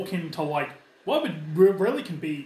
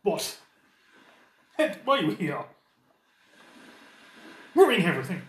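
high, loud, snooty aristocrat voice; haughty nobleman; 4 semitones higher, 3 dB louder, faster than usual. Acted. The cornered noble snaps at the peasant party, high and shrill. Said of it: "He's like".

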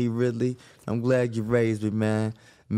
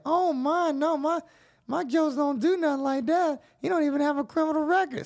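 much higher grandma voice, insisting; protective grandma voice; 13 semitones higher than usual. Retold or imitated. Grandmother insists to the cop her grandson is innocent, high old-lady voice. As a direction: defend her boy like a proud grandmother.

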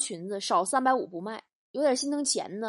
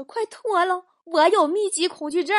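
put-on panicky boyfriend; 7 semitones higher, 6 dB louder than usual. Scared boyfriend blurts it out.

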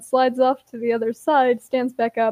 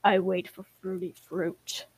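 put-on lower, softer, ominous little kid; dark refusal; 4 semitones lower, 4 dB quieter than usual. A narrator's reading. Ash turning on Ember to refuse, said darkly. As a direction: refuse darkly, keeping it low and quiet. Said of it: "darkly says".

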